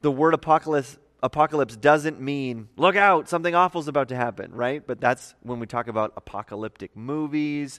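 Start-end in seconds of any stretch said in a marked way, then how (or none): 2.8-4.5 louder, higher, rushed mock alarm; mock warning. Teacher voicing the popular idea of apocalypse, mock alarm. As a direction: call out a hammed-up warning of doom.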